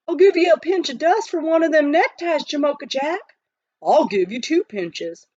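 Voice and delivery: mildly excited miners; eager bidding. Acted. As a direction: outbid the other miner.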